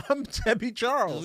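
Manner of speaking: put-on fan announcing her name; introducing herself. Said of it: "and they'd be like".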